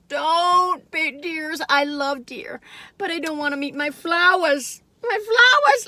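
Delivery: high, put-on pleading voice; mock scolding deer. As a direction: wail over the flowers. Scolding deer eating her flowers.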